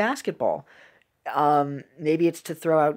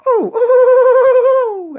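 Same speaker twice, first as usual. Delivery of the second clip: high, loud, slow drawn-out ooh cries; comic wail; 12 dB louder, slower than usual. Retold or imitated. Imitating the man falling down the stairs, high slow wailing oohs. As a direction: wail each ooh like a tumbling cartoon. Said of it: "So it was just like".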